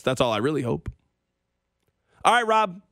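normal talking voice

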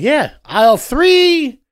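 loud, high and slow, selling it hard; eager tile clerk. Retold or imitated. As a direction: belt it like a cheerful salesman pointing the way. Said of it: "Person's like".

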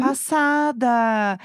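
drawn-out, slow shocked exclamation; stunned. Real, not acted. Host reacting to gossip about the house, stunned. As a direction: stretch it out in mock-scandalised amazement.

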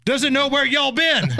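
mock Southern accent. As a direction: call it out like a hammed-up Southern football fan. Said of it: "I've never heard anybody in casual conversation ... say".

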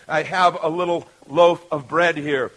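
ordinary talk, nothing marked

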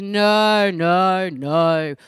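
loud, brushing-off no no no; dad laughing off the question, loud dismissive no; dad's laughing no. Retold or imitated. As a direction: laugh it off loudly, like dismissing a silly idea. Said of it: "And he says".

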